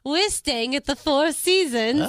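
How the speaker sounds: high, slow snooty boast; mock-posh old lady bragging; high slow showing-off lady voice.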